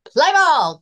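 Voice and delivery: high, loud ballpark call; umpire's cry. Acted. Umpire opening the baseball game, a high loud cry. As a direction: bark it like an umpire starting the game. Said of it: "cries the umpire".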